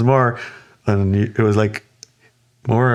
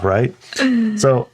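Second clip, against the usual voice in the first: normal talking voice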